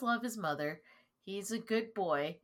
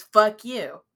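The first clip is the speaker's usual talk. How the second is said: shouted fuck you, much louder; loud rude retort; 2 semitones higher, 14 dB louder than usual. Retold or imitated. Rude noble shouting back at a friendly greeting. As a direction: bark it rudely at anyone greeting him. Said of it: "and he says".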